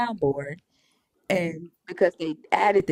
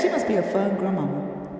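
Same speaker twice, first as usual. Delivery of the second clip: normal talking voice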